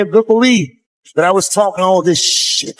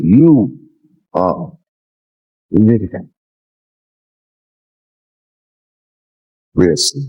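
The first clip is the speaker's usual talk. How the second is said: low, slow quote; 8 semitones lower, much slower than usual. Retold or imitated. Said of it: "And he said".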